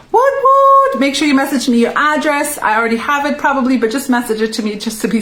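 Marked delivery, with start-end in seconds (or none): 0.1-1.0 much higher and louder celebratory whoop; excited cheer. Real, not acted. Host celebrating the winner on her live stream, gleeful cheer. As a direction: cheer like you just won something yourself.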